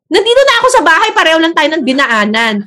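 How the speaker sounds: exasperated retort. Retold or imitated. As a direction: snap back with a quick irritated answer. Retold own exasperated reply. (naiinis na mabilis na sagot)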